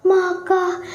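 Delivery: high, sung lament of a wronged mother; mother's prayer. A narrator's reading. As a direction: cry out to God in grief. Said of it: "kata emak".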